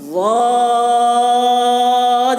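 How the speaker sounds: ضاد stretched very long, thick; long held letter name. Shown as a demonstration. Teacher demonstrates thick ضاد for students, drawn out. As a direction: hold ضاد out heavy and slow. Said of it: "یہ اس کے صحیح تلفظ ہے".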